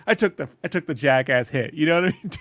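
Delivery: ordinary talk, nothing marked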